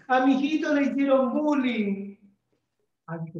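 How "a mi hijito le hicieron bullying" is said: higher and louder, mock pleading mom; a teacher mimicking a mother who complains her son was bullied; worried mother. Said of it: "viene una mamá y me dice".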